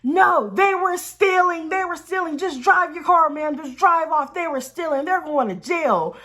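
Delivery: high, loud, insistent warning; urgent accusing shout. Retold or imitated. Storyteller voices fireman warning a driver off, urgent accusing shout.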